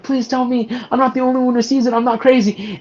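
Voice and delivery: high-pitched pleading, imitating a distraught woman; desperate plea. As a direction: act out her desperate plea, pitched well up. Acting out her desperate plea.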